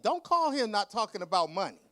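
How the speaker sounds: loud sassy. Retold or imitated. Author telling off her publisher, loud sassy version. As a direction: snap it at her, all sass. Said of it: "and I didn't say".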